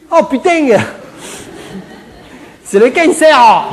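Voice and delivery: high, loud, jubilant Provençal exclamation; hammed-up southern French accent, last words stretched out. Provençal doctor gleefully announces the diagnosis to his patient, broad Provençal accent. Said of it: "avec sa, sa belle trogne méridionale qui s'illumine".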